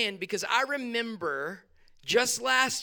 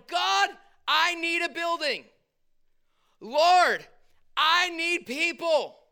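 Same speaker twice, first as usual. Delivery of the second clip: high-pitched, drawn-out cry to God; desperate plea; 8 semitones higher, 3 dB louder, slower than usual. Retold or imitated. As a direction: call out high and pleading, acting out desperation. Acted desperate prayer from last year.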